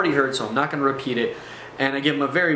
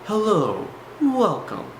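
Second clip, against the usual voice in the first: guru talk; 3 semitones higher than usual. Shown as a demonstration. Storyteller showing how the guru talks, mock guru voice. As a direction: put on a guru's spaced-out welcome. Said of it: "what I call the guru talk".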